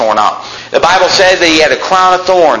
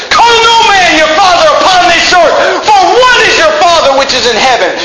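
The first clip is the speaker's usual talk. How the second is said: much higher, louder preaching shout; thundering scripture; 11 semitones higher, 4 dB louder than usual. Real, not acted. Preacher shouting scripture at his congregation, booming. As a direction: blast the verse out at full force.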